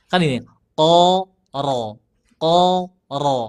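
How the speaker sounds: "ko" tebal lalu "ro" tebal; pancing "ro" dengan "ko"; ko then ro. Shown as a demonstration. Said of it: "dipancing pakai huruf qaf tadi". (ko lalu ro tebal)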